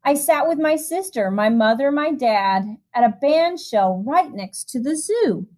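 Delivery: lilting storybook reading voice; rhythmic, gentle picture-book narration; reading to children. A narrator's reading. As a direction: read the rhyme aloud to a room of kids. Reads a rhyming picture book.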